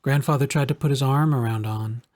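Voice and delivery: usual speaking voice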